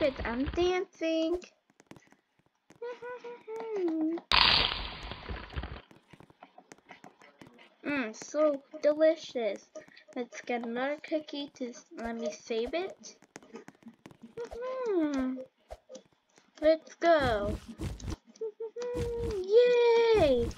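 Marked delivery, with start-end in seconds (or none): 2.8-5.8 giggle. Real, not acted. Laughs while dancing. 18.4-20.1 high shouted laugh. Real, not acted. Laughs, then cheers.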